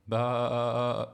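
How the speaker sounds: long, loud bleat; loud sheep baa. A narrator's reading. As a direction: interrupt with a drawn-out baa. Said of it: "Who is doing that awful noise".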